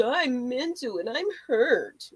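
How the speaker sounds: slow, drawn-out mock self-pity; mocking whiny excuse. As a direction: mimic someone milking their hurt. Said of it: "Somebody's treading lightly".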